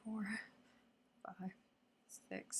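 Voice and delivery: hushed counting under the breath; whispered counting. Real, not acted. Counting the leftover cookies for viewers, whispered. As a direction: count them softly to yourself. Counting remaining treats quietly.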